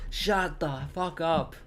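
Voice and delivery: slow, fed-up scolding of the dogs; stern rebuke. Real, not acted. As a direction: lay down the law, half laughing. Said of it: "You gotta be stern with the dogs".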